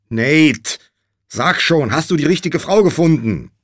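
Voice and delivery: loud, high impatient questioning; urgent demanding. A narrator's reading. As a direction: press him hard for an answer. Josh presses groggy Nate.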